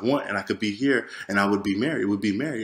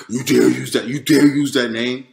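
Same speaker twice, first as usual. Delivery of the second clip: imitated roar, loud and altered in timbre; roaring, intimidating yell; 6 dB louder than usual. Devil's voice yelling over the name, imitated intimidating roar. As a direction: roar it as a looming threat. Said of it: "was a roaring, yelling, a very intimidating".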